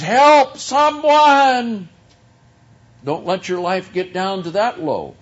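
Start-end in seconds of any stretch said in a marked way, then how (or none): 0.0-1.9 high, loud cry for help; acted desperate scream. Man down a well on a fraying rope, acted scream for rescue. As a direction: yell it out as if hanging down a well. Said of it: "screaming at the top of your lungs".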